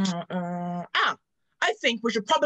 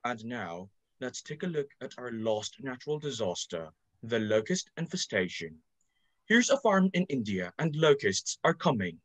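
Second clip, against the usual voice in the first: documentary narrator; 11 semitones lower than usual. Acted.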